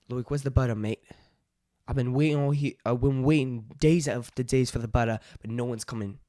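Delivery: mock complaint in an Aussie accent; practised broad Australian. Shown as a demonstration. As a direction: grumble like an amateur Australian. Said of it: "let's try Australian".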